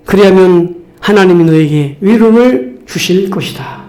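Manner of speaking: ordinary delivery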